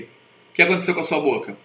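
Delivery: normal talking voice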